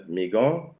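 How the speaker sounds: usual speaking voice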